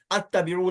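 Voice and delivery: अत्तबिऊ opening on "at"; initial short a. Shown as a demonstration. Teacher rules out a misreading, अत्तबिऊ with initial "a". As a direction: say अत्तबिऊ with an "a" as first vowel. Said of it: "नहीं कहेंगे".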